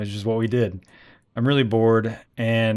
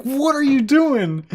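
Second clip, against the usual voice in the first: high, loud, drawn-out disbelief; mock outrage; 5 dB louder, much slower than usual. Husband to wife who shot the tree, mock outrage. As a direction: stretch it out in exasperated mock horror.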